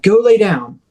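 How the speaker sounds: mock yell, no real volume; retold yell. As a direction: firmly act out ordering the dog. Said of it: "So I yell at him".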